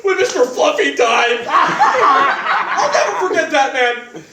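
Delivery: loud, mock-tearful wailing plea; hammed-up sobbing. [name] wails his love to his target Cody in a loud, hammed-up sob. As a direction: ham up the weepy brotherly love.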